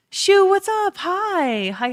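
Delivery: high, loud happy hello; squealing hi to a friend in chat; excited greeting. Real, not acted. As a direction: greet a chatter with a burst of delight.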